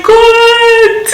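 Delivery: high, loud burst of relief; excited exclamation. Retold or imitated. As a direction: shout it out with joy. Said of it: "I was like".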